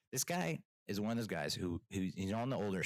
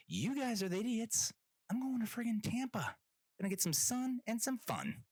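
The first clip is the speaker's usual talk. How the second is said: high-pitched, giddy vacation bragging; mock gleeful boast; 14 semitones higher than usual. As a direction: crow it way up high. Gleeful inner boast at airport.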